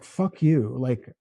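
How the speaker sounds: normal talking voice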